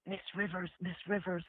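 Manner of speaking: hushed, timid call of her name; calling her name softly, almost under the breath. Retold or imitated. Meekly calling to Joan Rivers.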